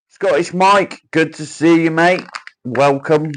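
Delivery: high, loud welcome to a mate; hearty greeting. Real, not acted. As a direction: call out a big hello as a friend walks in. Greeting a viewer arriving.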